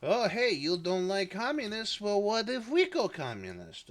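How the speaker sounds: wheedling mock French accent; terrible French accent. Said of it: "My accent is terrible".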